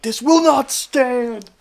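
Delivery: high, slow, grandiose proclamation; big heroic pronouncement, hammed up; mock-heroic Superman. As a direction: declare it slowly like a cartoon superhero. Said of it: "Superman's like".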